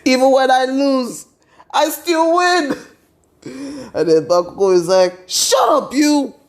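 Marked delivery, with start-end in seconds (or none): 0.1-2.8 smug gloating. Retold or imitated. Izuku gloats at Bakugo. 5.3-6.4 shrieking mock yell; angry shout. Bakugo snaps back at Izuku.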